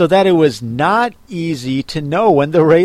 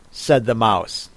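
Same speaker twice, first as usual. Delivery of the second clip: ordinary delivery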